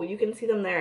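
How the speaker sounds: ordinary talk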